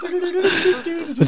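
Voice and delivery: high slow laughing words. Real, not acted. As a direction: say it through laughter.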